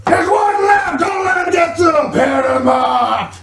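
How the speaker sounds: high, far-off battlefield shout; in-character shout. Acted. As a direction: shout the alarm across the field. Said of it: "as you hear".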